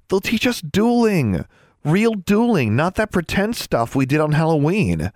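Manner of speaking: high-pitched, eager insistence; enthusiastic. A narrator's reading. Alexandra persuading unmoved Anna about dueling, enthusiastic. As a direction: sell it with bubbling enthusiasm. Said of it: "Alexandra's enthusiasm".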